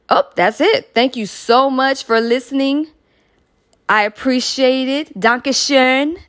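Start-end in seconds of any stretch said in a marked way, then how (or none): 5.2-6.2 higher, playful attempt at German; jokey German accent. Shown as a demonstration. Host thanking German listeners, jokey German accent. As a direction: try a German accent for fun. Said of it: "That's my little jokey attempt at, uh, at German".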